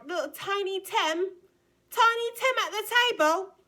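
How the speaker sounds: high, loud mock-British waif; bad British accent. Tarot reader casting Gemini as Tiny Tim, bad British accent. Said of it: "doing that British accent".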